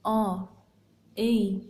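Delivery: आ and ए as separate, carefully held vowel sounds; native-speaker pronunciation. Shown as a demonstration. Teacher models आ and ए as native speakers say them, each separately. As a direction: say आ, then ए, each on its own. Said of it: "ऐसे ही एक native speaker इन्हें बोलता है".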